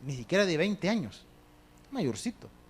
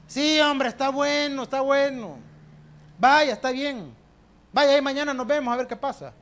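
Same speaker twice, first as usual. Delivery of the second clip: scoffing Pharaoh; 6 semitones higher, 4 dB louder than usual. Retold or imitated. Preacher voices mocking Pharaoh.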